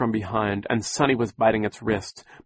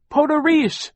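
put-on very high, loud baby shriek; 6 dB louder than usual. Baby Sunny shrieking at the creature holding her. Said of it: "Sunny shrieked".

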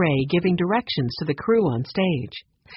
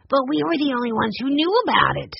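put-on higher-pitched boy insisting; insistent; 8 semitones higher than usual. A narrator's reading. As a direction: protest in a high child's voice. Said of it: "Benny insisted".

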